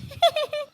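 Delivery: high, cackling jeer; a taunting cackle from the crows; mocking crow laugh. A narrator's reading. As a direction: laugh at the poor sparrow. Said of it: "हंसने लग जाते हैं".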